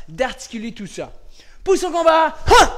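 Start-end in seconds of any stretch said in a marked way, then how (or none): 1.6-2.8 high, loud combat call with sharp kiai; forceful high karate shout; shouted command. Real, not acted. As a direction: bark the stance, then a short fighting cry. Calling combat position with kiai.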